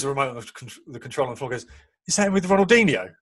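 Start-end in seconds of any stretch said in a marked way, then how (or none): 2.1-3.1 put-on higher, louder kid's question; a boy who just dropped the controller in excitement; excited child. Son excited over photo.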